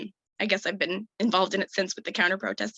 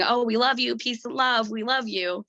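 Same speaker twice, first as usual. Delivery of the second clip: higher, mildly mocking chant of a crowd; mock yelling; 4 semitones higher than usual. Resident imitates the laughing crowd around her, mock yelling. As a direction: imitate jeering people without really shouting. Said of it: "starts laughing and yelling".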